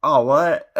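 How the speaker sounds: stunned disbelief. Retold or imitated. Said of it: "And I was like".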